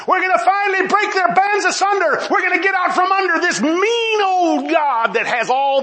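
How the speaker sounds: high, strained mock defiance; defiant rebels. Preacher acting the heathen rebelling against God, mock-defiant. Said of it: "that's what these people did".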